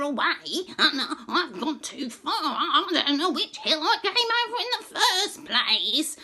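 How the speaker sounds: high laughing duck voice; giggly duck voice. Acted. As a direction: chatter like a silly lost duck. Duck character speaks.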